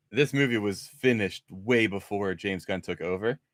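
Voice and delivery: ordinary talk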